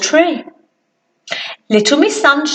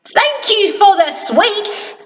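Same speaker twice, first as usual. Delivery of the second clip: lightly voiced Mister Small; 7 semitones higher than usual. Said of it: "said an unhappy Mister Small".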